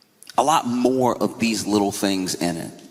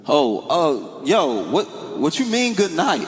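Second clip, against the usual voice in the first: higher, bewildered friend; confused friend voice; 7 semitones higher, slower than usual. Retold or imitated. Friend on the phone, higher and bewildered. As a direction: ask it high and bewildered.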